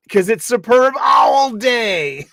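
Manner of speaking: slow, high, loud announcement; shouted punchline. Pretend. Host announcing Superb Owl Day to viewers, loud slow shout. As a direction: proclaim it like a big holiday.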